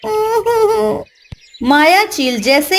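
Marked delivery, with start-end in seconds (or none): 0.1-1.1 drawn-out high whimper of a lost chick; high slow whimper. Acted. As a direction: let out a long helpless whimper.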